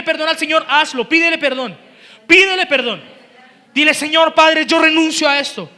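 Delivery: loud, driving prayer over music; fervent prayer. Acted. Pastor leading the church in prayer, fervent and loud. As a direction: cry out to God on behalf of all.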